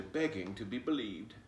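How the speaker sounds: ordinary delivery, nothing marked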